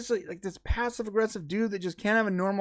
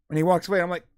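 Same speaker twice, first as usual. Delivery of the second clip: normal talking voice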